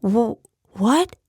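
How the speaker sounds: high, stammered "what"; flustered boy; startled. Acted.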